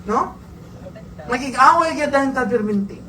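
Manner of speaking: ordinary talk, nothing marked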